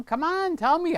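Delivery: high, teasing come on; playful coaxing. Real, not acted. Coaxing a viewer to share news, playful. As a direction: coax the news out of a friend.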